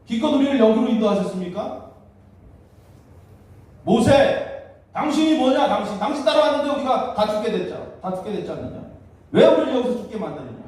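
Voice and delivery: acting out indignant, high-pitched accusations; resentful complaint. Israelites blame God and Moses for leading them to die, resentful. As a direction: protest loudly as the wronged people. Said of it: "원망한 거예요".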